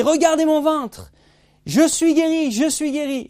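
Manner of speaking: high, loud, slow proclamation; excited exclamation. Retold or imitated. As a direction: call everyone to look, thrilled. Healed man shows his belly.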